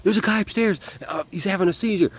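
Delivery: high, loud, hurried cry for help; retold urgent alert. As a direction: raise the alarm in a rush.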